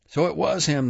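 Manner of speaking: high-pitched cry without much volume; mild shout, pitched well up; crowd's shout. A narrator's reading. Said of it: "they shouted".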